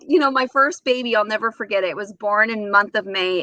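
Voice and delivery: normal talking voice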